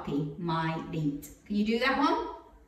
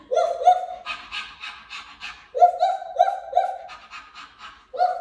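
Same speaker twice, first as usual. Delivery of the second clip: dog barking; faster than usual. Shown as a demonstration. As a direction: bark like a little dog. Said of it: "Can you be a dog for me?".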